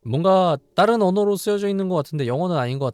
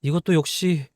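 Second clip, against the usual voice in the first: higher, louder distressed daughter; acted heroine dialogue; 7 semitones higher, 5 dB louder than usual. Heroine refusing to believe her mother is cursed, higher and louder. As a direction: plead that mother would never do this.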